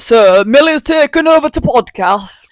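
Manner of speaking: high, exaggerated northern English; playfully put-on northern lilt; northern English accent. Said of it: "I'm gonna be more northern".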